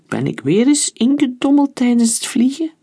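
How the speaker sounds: lifted, puzzled question; surprised. Acted. Pilot waking, asking himself, surprised. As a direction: wake up confused, voice high.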